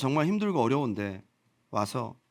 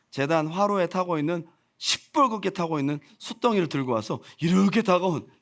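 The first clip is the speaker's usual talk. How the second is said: normal talking voice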